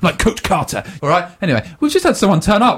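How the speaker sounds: ordinary talk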